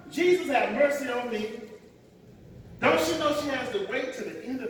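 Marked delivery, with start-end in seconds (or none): none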